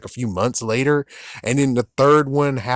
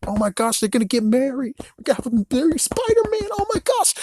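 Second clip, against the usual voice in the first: much higher, faster giddy squeal; fangirl squeal; 13 semitones higher, faster than usual. Retold or imitated. Imitating a fan over the wedding, giddy high squeal.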